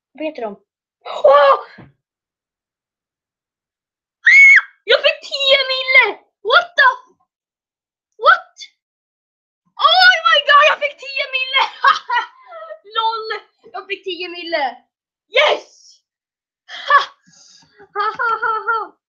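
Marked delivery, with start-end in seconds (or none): 1.2-16.0 much higher, loud screaming joy; ecstatic win. Real, not acted. Gamer yelling to stream after winning ten million, ecstatic shouting. 16.9-19.0 triumphant laugh. Real, not acted. Gloating after big win.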